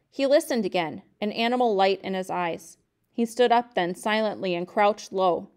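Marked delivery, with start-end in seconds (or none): none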